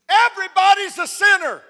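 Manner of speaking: high retold shout; loud proclamation. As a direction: announce it to the whole coffee shop. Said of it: "as loud as he told everybody".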